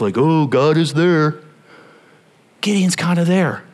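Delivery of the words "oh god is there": slow, sarcastic drawl; mock-pious jaded churchgoer; jaded sarcasm. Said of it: "feeling a little jaded".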